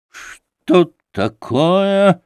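doctor startled by Kolya's insult, slowly asking; surprised. A narrator's reading. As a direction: toss the head up in surprise. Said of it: "удивлённо уставившись".

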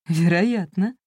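ordinary delivery, nothing marked

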